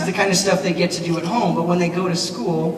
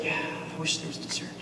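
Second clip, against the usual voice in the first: whispered, mock-bored aside from the crowd; 13 dB quieter than usual. Speaker imitating a bored audience muttering about dessert, mock whisper. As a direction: breathe it like a bored kid in the back.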